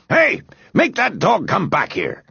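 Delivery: cross, high-pitched demand; snapping order. A narrator's reading. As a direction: call out an irritated order. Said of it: "snapped Mister Grumby".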